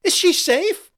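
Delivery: high, loud desperate cry; frantic scream. A narrator's reading. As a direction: scream it in panic. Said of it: "the first lady screamed".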